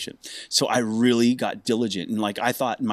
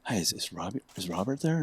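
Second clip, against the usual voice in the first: much softer, hesitant caller; soft phone voice; 9 dB quieter than usual. Retold or imitated. George Lynch on the phone, quietly asking for Robert. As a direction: ask for Robert tentatively. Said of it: "and it's like".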